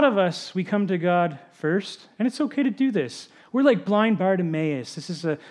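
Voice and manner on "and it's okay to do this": usual speaking voice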